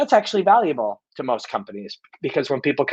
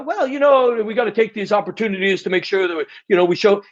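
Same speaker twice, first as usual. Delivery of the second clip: broadcaster talk; 6 semitones higher than usual. Retold or imitated. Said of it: "stop talking like a broadcaster".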